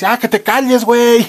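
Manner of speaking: high, loud cut-in; mock exasperated yell. Husband silences his nagging wife, mock yelling. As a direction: blow up at your partner, hammed-up.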